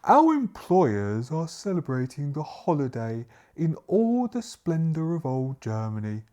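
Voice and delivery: grand, projected character speech; butler's voice. Acted. Butler reassures guests about the Baron's welcome, grand delivery. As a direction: proclaim it with swelling emphasis.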